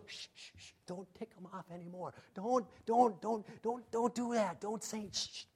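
hushed, urgent stage whisper to a friend; frantic hushing. Acted. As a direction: shush him under your breath, pleading. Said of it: "maybe John or Andrew's going".

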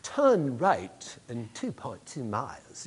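a light imitation of a slow, measured satnav voice; GPS lady voice. The preacher imitating his GPS voice on the road, slow and measured. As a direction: read it out slowly like a navigation system. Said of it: "I just kinda like her voice on my G P S".

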